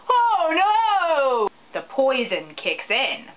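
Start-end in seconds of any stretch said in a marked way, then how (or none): 0.1-1.5 drawn-out, high melodramatic cry; hammy "oh no" sliding slowly down; mock alarm. Podcaster faking horror at the plot twist, mock-dramatic wail.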